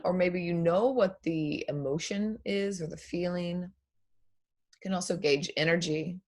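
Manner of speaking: soft, unhurried meditation voice with long pauses; guided meditation. Acted. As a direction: guide them gently through breath and body. Leads a breathing meditation.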